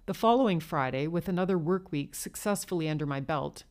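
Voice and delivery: ordinary delivery, nothing marked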